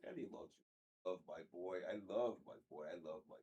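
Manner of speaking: soft, hushed cooing to a pet; tender dog talk. Real, not acted. Owner cooing softly to his dog, tender and hushed. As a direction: coo softly to your dog.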